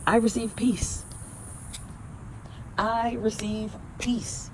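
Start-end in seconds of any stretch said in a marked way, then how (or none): 2.8-4.5 slow and softer, savoring each word; slow, soft affirmation. Shown as a demonstration. Affirmation for viewers, slow and soft. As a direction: let peace sink in, slowly and softly. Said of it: "Repeat after me".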